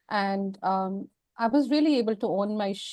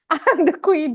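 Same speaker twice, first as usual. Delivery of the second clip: louder proud boast; 6 semitones higher, 6 dB louder than usual. Retold or imitated. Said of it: "I was like".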